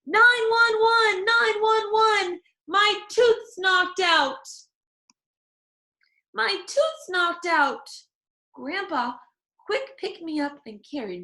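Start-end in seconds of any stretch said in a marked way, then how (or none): 0.1-4.5 high, loud, slow frantic calls; yelling for help. A narrator's reading. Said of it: "I zoomed to the front door". 6.3-7.9 high shouted cry; yelling. A narrator's reading. Girl yells tooth knocked out.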